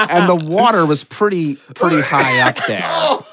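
high, laughing through words; real laughter breaking in. Real, not acted. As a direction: giggle through the gross part. Laughing while telling.

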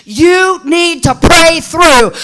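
slow, loud, high shouted advice; old timers' shout. Retold or imitated. Old timers correcting a child with an attitude, shouting. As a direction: bellow it like the church elders did. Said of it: "what old timers used to tell me".

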